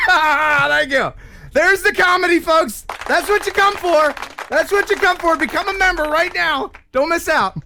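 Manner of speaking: hype announcer. Acted. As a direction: sell the joke like a hype man. Self-mocking comedy hype.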